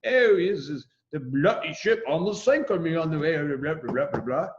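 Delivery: a lightly put-on British accent, bloody stressed; tossed-off imitation of a Brit, British accent; mock British accent. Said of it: "the British are running around saying".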